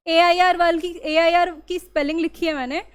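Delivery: usual speaking voice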